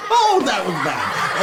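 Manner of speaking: chuckling through the words; laughing at own joke. Real, not acted. Host laughing at his own bad joke to the kids. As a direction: laugh as you groan at the pun.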